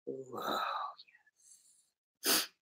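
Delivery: breathy, soundless "whoa"; a hushed gasp of pleased surprise; whispered whoa. Real, not acted. Reacting quietly to a find.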